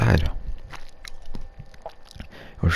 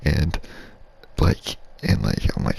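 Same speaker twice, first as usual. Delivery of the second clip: ordinary talk, nothing marked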